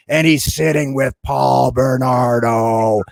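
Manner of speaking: slow, drawn-out delivery like a slow-motion replay. Retold or imitated. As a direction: stretch every word as if time slowed down. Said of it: "it looked like it was in slow motion".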